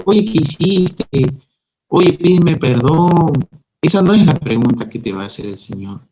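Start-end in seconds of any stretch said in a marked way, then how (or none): 0.0-3.3 loud, slow reproachful questioning; mock scolding. Preacher acting out a God who scolds. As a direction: scold like a stern judge.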